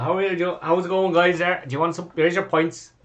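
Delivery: a lightly voiced friendly barkeep; barman greeting. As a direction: play the cheery Dublin barman. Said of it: "he goes".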